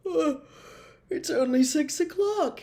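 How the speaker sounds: sleepy yawn breaking into the line; mid-yawn, drowsy and surprised. Acted. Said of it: "he observed with a yawn".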